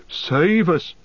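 high, alarmed exclamation; frightened cry. Acted. Landlord hearing the name Mordor, crying out in fright. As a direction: gasp it out in dread. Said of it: "cried Mister Butterbur".

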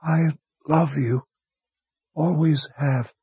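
high, slow, faint voice of a dying man; a wounded man using his last strength to speak; dying young wizard. A narrator's reading.

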